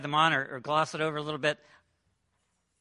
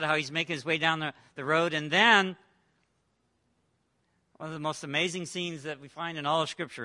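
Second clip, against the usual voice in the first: ordinary talk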